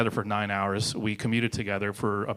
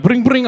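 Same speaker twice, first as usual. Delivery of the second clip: loud, high trilled brring; piercing playful sound effect; imitated flicking noise; 13 semitones higher, 12 dB louder than usual. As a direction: mimic a phone-like ring, sudden and high. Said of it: "he just kept going".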